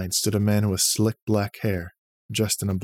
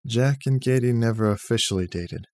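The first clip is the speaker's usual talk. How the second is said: plain reading voice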